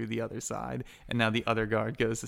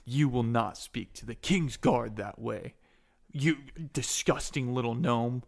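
measured rebuke building to a loud threat; acted indignation, growing louder; outraged guard; 5 dB louder than usual. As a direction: scold as an offended royal guard. Guards rebuke an insolent gnome.

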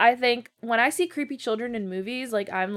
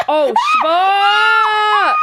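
startled scream; 11 semitones higher, 12 dB louder, much slower than usual. Real, not acted. Two friends watching a horror movie, startled shriek. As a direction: gasp then shrieked curse, much higher and louder.

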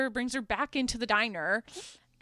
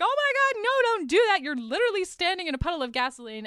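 high, loud alarmed cry of warning; mock-horrified yell at the man outside; horrified shouting; 8 semitones higher, 5 dB louder, faster than usual. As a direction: diner crowd shrieking at the smoker. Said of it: "all these people are in the window like".

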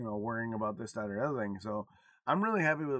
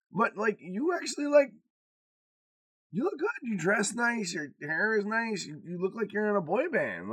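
high, louder gushing praise; daughter's voice; 8 semitones higher, 4 dB louder than usual. Retold or imitated. Dad voices daughter gushing about his looks, pitched up. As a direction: gush like an admiring daughter. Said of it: "She goes".

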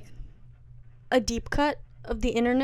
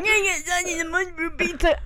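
higher, garbled New Jersey Italian caricature; thicker New Jersey accent; 6 semitones higher than usual. Retold or imitated. Retelling a video where the accent gets progressively worse, garbled New Jersey accent. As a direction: slur the order into an overdone Jersey accent. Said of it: "progressively, like, him getting more, like, into the accent".

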